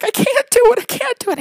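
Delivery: high mock whine of a flustered child; almost crying. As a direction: imitate a little girl near tears. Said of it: "she starts almost crying".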